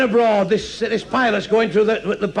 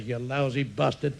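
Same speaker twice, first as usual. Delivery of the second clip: low-volume, drawn-out insult; slow, soft jeer from the back; muttered heckle; 4 semitones lower, 9 dB quieter than usual. Acted. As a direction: grumble the insult under your breath.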